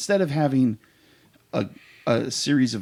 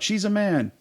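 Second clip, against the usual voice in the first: retold yell; 10 semitones higher than usual. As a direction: call it out as a retold heckle. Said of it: "And I yell out".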